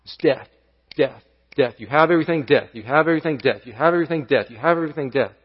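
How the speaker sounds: soft, heavy repetition of "death"; rhythmic death refrain. Shown as a demonstration. Preacher hammering the refrain to the church, softly and rhythmically. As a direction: toll "death" like a bell after each line. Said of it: "ends with the word died".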